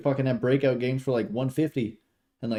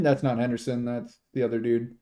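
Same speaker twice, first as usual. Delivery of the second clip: normal talking voice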